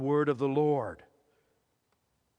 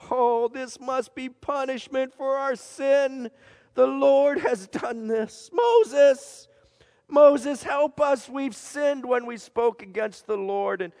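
higher, calling out Moses' name in panic; desperate pleading; 6 semitones higher, 4 dB louder than usual. Acted. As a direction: beg Moses for help. Bitten people plead with Moses.

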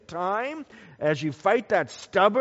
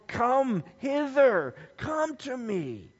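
powerful call; 6 semitones higher, slower than usual. Acted. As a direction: call out, then let it fall away. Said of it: "with that powerful".

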